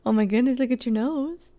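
light cooing at the dog; a hint of pet voice. Real, not acted. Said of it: "my pet voice".

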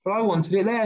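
mildly acted protest; protesting child. A narrator's reading. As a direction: argue back as a put-out child, without shouting. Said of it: "protested Peter".

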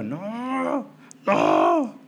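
squirm out a mortified no; embarrassed protest. Retold or imitated. (protesta avergonzada)

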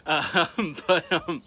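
laughing while trying to talk; fighting back a giggle; stifled giggles. Real, not acted. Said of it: "I'm trying not to laugh at her".

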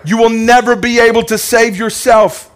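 loud, high, driving sermon build; fiery preacher at full force; preaching climax. Acted. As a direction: hammer "ever" home to the crowd.

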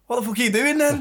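high-pitched, exasperated demand; mock-outraged challenge; retold angry outburst. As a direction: imitate an angry Mancunian's disbelief. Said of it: "he was properly angry about it".

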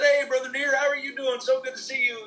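imitating cheery greeting. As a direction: play the delighted churchman greeting a friend. Retold cheerful greeting from churchman.

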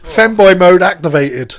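loud, slow robot announcement; robot announcing. Acted. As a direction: announce the mode switch. Robot then cute voice.

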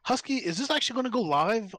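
ordinary talk, nothing marked

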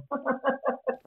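bright burst of real laughter. Real, not acted. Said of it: "I laughed".

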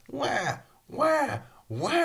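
high mimicked wailing in bursts; imitated baby crying. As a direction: wail like a baby, repeatedly. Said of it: "so he started crying".

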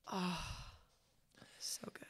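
a hushed, reverent so good; awed whisper. Real, not acted. Fan whispering in awe about the Joker's drawl. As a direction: breathe it out in a whisper.

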